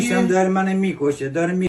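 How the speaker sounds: very low fast voice; low cartoonish moan. Pitched-down character complains. (صدای بم و تند)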